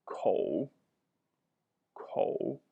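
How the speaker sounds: "kou" dipped low in third tone, half whispered. Shown as a demonstration. Teacher modeling Chinese for learners, low dipping tone. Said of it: "third tone".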